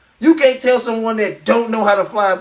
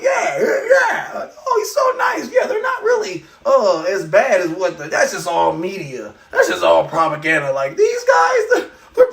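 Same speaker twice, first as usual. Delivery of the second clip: falsetto mock; 2 semitones higher than usual. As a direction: gush in a shrill mock falsetto. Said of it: "I'm like".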